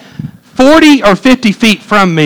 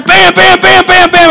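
high, excited volley of bams; imitated rapid gunfire; 11 semitones higher than usual. Preacher retelling his stand against pigs, imitating rapid gunfire. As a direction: fire off shots with your voice, pitched way up. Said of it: "I started firing".

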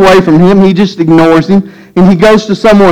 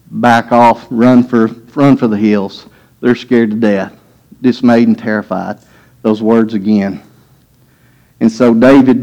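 steady preacher's storytelling; calm Bible story telling; sermon narration; 5 semitones lower than usual. Acted. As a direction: lay out the story for the flock. Preacher retelling David story.

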